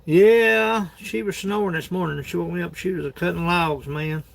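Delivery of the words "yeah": loud yeah. Real, not acted. Loud burst after long pause.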